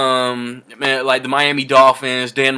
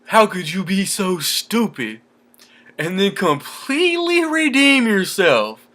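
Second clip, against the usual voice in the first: slow, much higher mock movie voice; imitating Jeff Daniels; 6 semitones higher, slower than usual. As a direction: stretch it out like the dumb character. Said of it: "Jeff Daniels was like".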